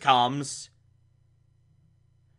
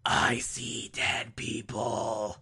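slow, hushed whisper; creepy whispered movie-quote imitation; eerie mock whisper; 5 semitones lower, 10 dB quieter, much slower than usual. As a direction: whisper it like a haunted confession. Film quote as his reaction.

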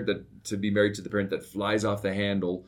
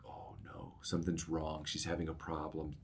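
hushed, much softer, half-breathed worry; worried thought; 3 semitones lower, 13 dB quieter than usual. Retold or imitated. Father's worried inner thought when his daughter asks for him alone, hushed. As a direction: murmur it like a private dread.

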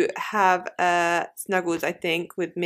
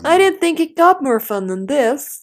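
loud, high TV presenter delivery; commentator voice; 7 semitones higher, 8 dB louder than usual. Pretend. Podcast host joking to co-host in a loud commentator voice. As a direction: pitch it up like an excited voiceover announcer. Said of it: "is it like a commentator voice".

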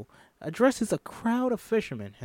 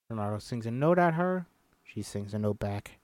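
ordinary delivery

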